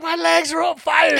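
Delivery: high, loud wail of alarm; retold panic. Boy tells his mom about burning legs, panicked. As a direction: yell it like a panicking kid. Child cries out, bitten by ants.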